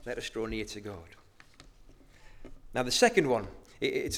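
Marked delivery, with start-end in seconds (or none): 0.0-1.2 "Let us draw near to God" said again quietly, much softer; hushed echo of "Let us draw near to God"; soft repeat. Shown as a demonstration. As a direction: let the line fall soft and low. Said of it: "the first emphatic emphasis".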